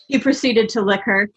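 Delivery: ordinary delivery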